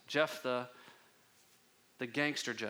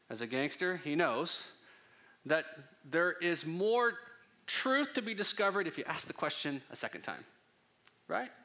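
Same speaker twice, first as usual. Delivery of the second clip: ordinary talk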